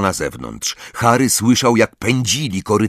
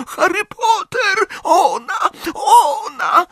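very high, wailing little creature; frantic elf screech; slower than usual. A narrator's reading. Terrified elf warns Harry about her, shrill wail. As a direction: squeal each word in panic.

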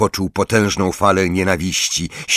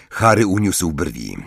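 usual speaking voice

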